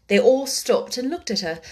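ordinary delivery, nothing marked

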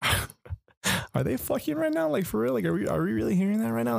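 can't believe what he's hearing; disbelieving. Retold or imitated. Asking his date at night, hearing moaning next door, stunned and incredulous.

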